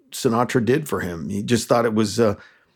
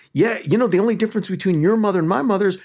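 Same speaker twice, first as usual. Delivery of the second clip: high, animated joke setup; imitating an eager comic, high and quick; excited comic retelling; 6 semitones higher, faster than usual. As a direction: pitch it up and run quickly into the punchline.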